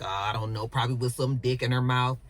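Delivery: imitating a man's low offhand reply; dismissive man's voice. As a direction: play him grudging and unbothered.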